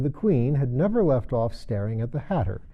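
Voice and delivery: usual speaking voice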